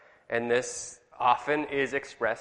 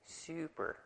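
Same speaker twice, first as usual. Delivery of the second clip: soft, drawn-out Californian "Super"; Californian accent; 3 semitones higher, 16 dB quieter than usual. Shown as a demonstration. Teacher asks class to say it with him, Californian "Super". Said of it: "if you want to sound like a Californian".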